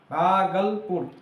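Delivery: Bhagalpur slowly divided as भागल ... पुर; two-part syllable split. Shown as a demonstration. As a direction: draw out Bhagalpur in two parts, breaking before पुर. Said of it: "इसमें भी दो syllable है". (भागलपुर को धीरे-धीरे दो खंडों में, भागल ... पुर)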